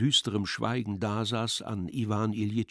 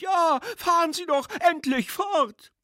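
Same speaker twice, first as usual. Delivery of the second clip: very high, louder piping youth; heckler's voice; 5 dB louder than usual. A narrator's reading. Drunk schoolboy tells the speaker to continue, heckling. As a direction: urge him on in a high put-on voice. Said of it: "erklang die Stimme erneut".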